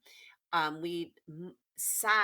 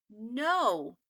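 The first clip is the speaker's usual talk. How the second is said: mild shout; 6 dB louder than usual. Acted.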